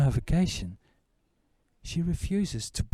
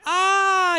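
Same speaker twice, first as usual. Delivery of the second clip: high, surprised ah; light up in recognition; 4 dB louder than usual. Retold or imitated. Policeman recognizing him.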